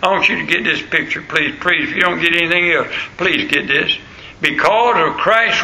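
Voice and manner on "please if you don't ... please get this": ordinary talk, nothing marked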